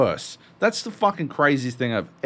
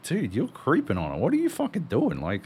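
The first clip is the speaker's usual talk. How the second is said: incredulous scolding; 8 semitones higher than usual. Retold or imitated. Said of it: "And you're like".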